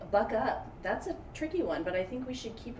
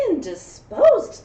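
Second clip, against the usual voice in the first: dramatic realization; 7 semitones higher, 13 dB louder, much slower than usual. A narrator's reading. Character's thought, dramatic.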